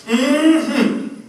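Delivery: high, loud, knowing hum; pointed mm-hmm. Retold or imitated. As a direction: imitate a friend's scornful mm-hmm. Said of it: "This to show me what he thought".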